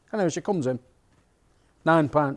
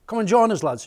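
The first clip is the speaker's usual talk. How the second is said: calling out; 8 semitones higher than usual. Retold or imitated. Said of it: "calling across restaurant".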